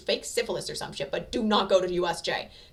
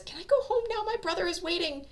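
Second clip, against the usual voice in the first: tearful woman; 12 semitones higher than usual. A narrator's reading. Hanako tearfully asking Aizawa.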